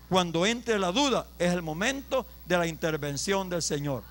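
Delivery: normal talking voice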